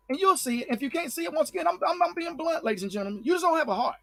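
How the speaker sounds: heated rant. Real, not acted. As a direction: let it boil over at them.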